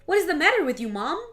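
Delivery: usual speaking voice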